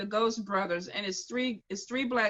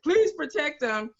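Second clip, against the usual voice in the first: higher, louder imitation of praying; mock pleading prayer; 5 semitones higher, 6 dB louder than usual. Man praying for friends in a haunted mortuary, loud mock plea. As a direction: plead like someone praying his ass off. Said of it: "he was praying his ass off for them".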